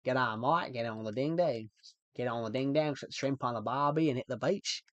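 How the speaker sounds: put-on Australian accent, stock slang. Shown as a demonstration. Streamer answers chat by demonstrating an Australian accent. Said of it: "I can do an Australian accent".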